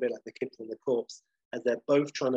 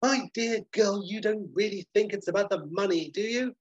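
high-pitched, theatrical lecturing; condescending hotelier; 6 semitones higher than usual. Acted. Basil lecturing Polly about money, high pompous condescension. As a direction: lecture her with grandiose condescension. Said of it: "Basil's like".

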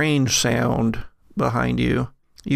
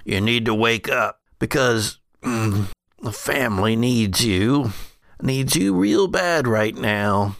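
put-on regretful older brother; 3 semitones lower than usual. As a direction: urge him weightily, as a regretful elder brother.

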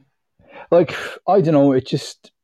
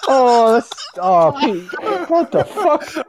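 shocked; 9 semitones higher, much slower than usual. Real, not acted. Reacting to friend's toilet noise.

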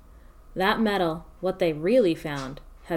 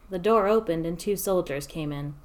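ordinary delivery, nothing marked

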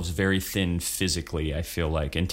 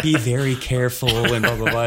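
louder, higher mock warning voice; mock cautious warning; 3 semitones higher, 6 dB louder than usual. Teachers warning improvisers about physical risk, mock caution. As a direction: parody the nervous safety talk. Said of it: "everyone's always like".

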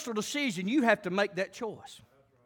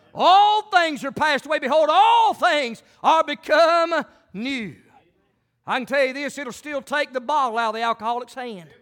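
louder, higher preacher's cry; full-force preaching; 6 semitones higher, 7 dB louder than usual. Acted. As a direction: preach it out to the congregation.

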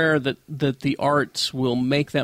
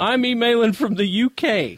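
higher-pitched attempted British accent; put-on British accent; 6 semitones higher than usual. Reader retrying Tom's line from the letter, put-on British. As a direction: try an educated British accent. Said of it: "He sounded really educated for a second".

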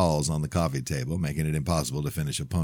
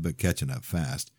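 usual speaking voice

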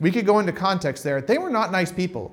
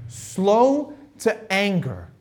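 slow emphasis; 3 semitones higher, much slower than usual. Shown as a demonstration. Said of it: "stressing God's character".

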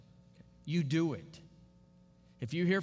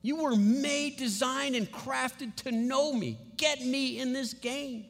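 impassioned plea, higher and drawn out; God's urgent appeal; 5 semitones higher than usual. Acted. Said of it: "He's saying".

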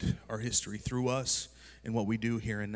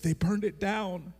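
higher, slow, teary mock sob; imitating crying wife; 8 semitones higher, slower than usual. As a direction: play his heartbroken wife blurting the news through tears. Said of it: "And she's like / bawling her eyes out".

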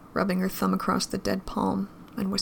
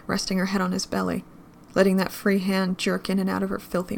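usual speaking voice

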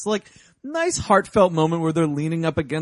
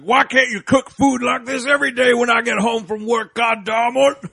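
higher, louder, scolding complaint; mock yelling; 6 semitones higher, 4 dB louder than usual. Hermit yelling at the rabbit about cooking, in a mock scold. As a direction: nag the rabbit like a grumpy husband home from work. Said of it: "yelling at the rabbit thing".

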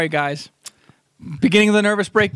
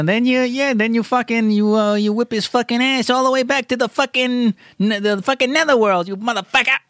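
higher tough-guy gangster rant; Joe Pesci; 7 semitones higher than usual. Pretend. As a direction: channel a hotheaded mobster, swearing. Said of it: "why did I just morph into Joe Pesci?".

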